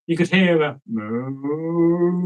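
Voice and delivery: slow, far-off moo; faint distant moo. A narrator's reading. Narrator voicing a faint moo across the plain. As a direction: draw out a moo from far away. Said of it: "in the very faint distance".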